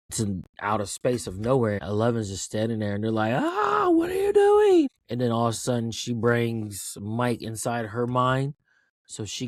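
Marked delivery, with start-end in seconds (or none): 3.3-4.9 very high, loud imitation of a panicked cry; mock panicked scream. Friends seeing Eleven stay at the portal, mock panicked scream. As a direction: shriek it as the horrified friends, hammed up.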